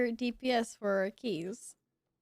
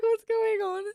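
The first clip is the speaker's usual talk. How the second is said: surprised; 10 semitones higher than usual. Retold or imitated. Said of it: "I was like".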